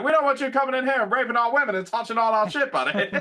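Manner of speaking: high, mock Canadian voice; imitating a South Park Canadian; Canadian accent. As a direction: sneer it out like a Canadian border guard. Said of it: "And he's like".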